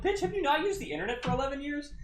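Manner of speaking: high, fast, incredulous comeback; incredulous mockery. Storyteller scoffing at a clueless drunk woman, high-pitched incredulity. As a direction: pitch way up in mock disbelief.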